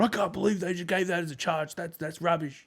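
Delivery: high, exasperated complaining voice; mock outraged fan. Commentators imitating their own weekly complaints about charge calls, high and exasperated. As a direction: whine about a bad charge call. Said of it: "have we been sitting here going".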